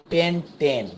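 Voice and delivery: পেন্টেন said with a clear "en" sound at the end; stress on the -এন ending. Shown as a demonstration. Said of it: "উচ্চারণটা এন এর মতো হচ্ছে".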